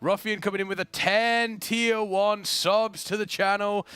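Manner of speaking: slow hyped-up announcer read; hoarse sleep deprived hype. Acted. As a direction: call out the subs like an announcer. Announcing gifted subs.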